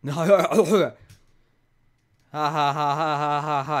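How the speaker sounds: loud real laughter; laughing. Real, not acted. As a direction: burst out laughing. Laughing at the sleepy remark.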